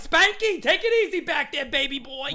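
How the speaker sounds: calling out to Spanky, playful; mock yell. Imitating teammate yelling from behind.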